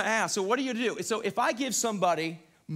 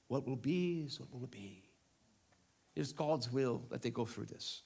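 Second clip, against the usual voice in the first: put-on low, soft British accent, official-sounding; 5 semitones lower, 7 dB quieter than usual. Preacher mimics resigned believer, in an official British accent. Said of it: "I'm using a British accent".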